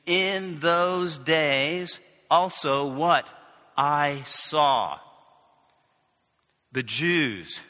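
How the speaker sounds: slow, deliberate Bible reading; scripture reading. Acted. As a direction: read the verse out slowly to the congregation. Reads scripture slowly.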